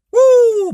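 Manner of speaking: high, loud joyful whoop; ecstatic whoop. Real, not acted. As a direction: let out a praise whoop. Second whoop after verse.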